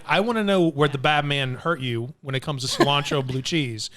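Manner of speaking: normal talking voice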